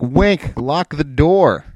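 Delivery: hammed-up order, stretched out; acted champion's order. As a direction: call it out slowly, in play. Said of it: "I'll just be like".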